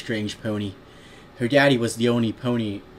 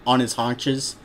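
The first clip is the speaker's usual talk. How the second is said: ordinary delivery, nothing marked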